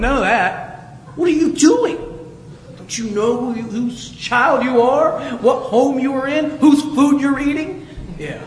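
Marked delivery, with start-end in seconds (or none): 1.2-7.9 mock-outraged scolding. Father reminding his son whose house it is, mock indignation. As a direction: rattle off outraged questions at a wayward child.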